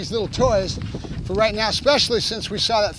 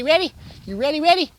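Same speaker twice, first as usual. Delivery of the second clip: high, louder, eager questions; excited revving up; 7 semitones higher, 4 dB louder than usual. Real, not acted. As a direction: tease the dog into readiness. Revving up the dog for play.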